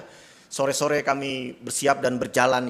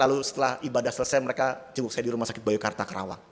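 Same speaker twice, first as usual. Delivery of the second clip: ordinary delivery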